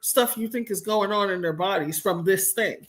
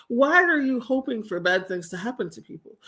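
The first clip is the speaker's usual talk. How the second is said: normal talking voice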